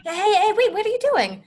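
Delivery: high, loud startled objection; alarmed protest. Acted. Fiona to the knight grabbing her, alarmed protest. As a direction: protest at being manhandled.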